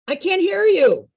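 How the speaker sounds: high call-out to a crowd; rousing call. Pretend. Leader urging group to say arr, rousing call. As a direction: egg them on, pitched well up.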